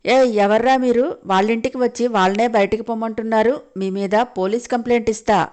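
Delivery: plain reading voice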